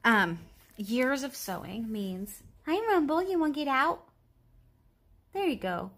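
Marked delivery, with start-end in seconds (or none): 2.7-5.9 pet voice. Real, not acted. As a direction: coo at the pet. Talking to her pet.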